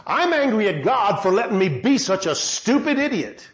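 normal talking voice

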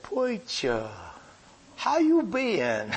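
high, slow, laughing call of "Preacher, how you been"; a sick old friend's delighted drawn-out hello; Herbie's high greeting. Retold or imitated. Herbie greeting the preacher from his hospital bed, high and laughing.

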